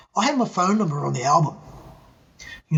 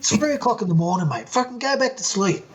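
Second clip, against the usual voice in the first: annoyed; 7 semitones higher than usual. Retold or imitated. Annoyed at a 3am call.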